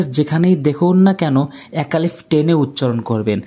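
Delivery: ordinary talk, nothing marked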